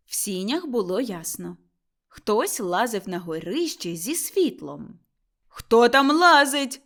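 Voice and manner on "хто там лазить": slow, loud shout upward; shouting up to the attic; loud call. A narrator's reading. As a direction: call out sharply into the dark. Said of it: "гукнув Лаврін".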